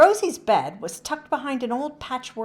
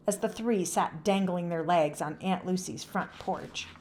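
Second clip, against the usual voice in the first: ordinary delivery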